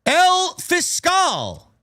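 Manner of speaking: high, stretched-out ring announcer name call; booming ring intro, very slow. Pretend.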